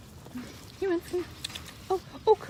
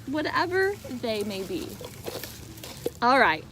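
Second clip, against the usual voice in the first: plain reading voice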